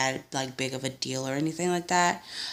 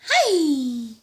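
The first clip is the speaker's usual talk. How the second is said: greeting her son; 11 semitones higher, 4 dB louder than usual. Real, not acted.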